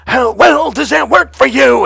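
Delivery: high, loud, sing-song yell; yelled at him. Retold or imitated. Coach yelling obnoxiously to snap the student out, loud high yell. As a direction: belt it at him to break his pattern. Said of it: "then I literally yelled at him".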